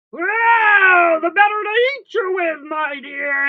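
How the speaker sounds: very loud, high wolf outburst; pouncing wolf. Acted. Wolf pounces on Little Red, loud and ravenous. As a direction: burst out full force, dropping as you go. Wolf reveals himself, attacks.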